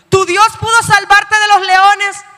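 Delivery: high, loud call down the pit; king calling down into the den; anxious shout. Acted. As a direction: shout the question, desperate for an answer. King calls into lions' den.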